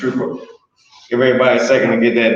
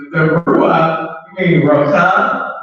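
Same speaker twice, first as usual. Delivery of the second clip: ordinary talk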